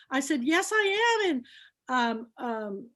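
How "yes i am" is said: bright eager yes. Retold or imitated. Retold reply at hotel desk.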